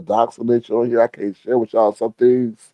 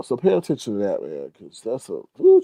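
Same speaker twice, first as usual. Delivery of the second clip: usual speaking voice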